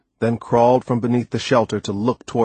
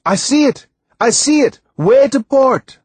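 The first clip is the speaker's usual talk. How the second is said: high, loud cries of a lookout; excited yell; 7 dB louder than usual. A narrator's reading. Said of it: "Timothy yelled".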